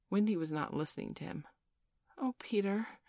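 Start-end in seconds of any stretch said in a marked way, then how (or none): 2.2-3.0 high, understated exclamation; yearning cry. A narrator's reading. Wendy longs to see the fairy, yearning cry. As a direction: sigh out a wish, pitched up. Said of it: "she cried".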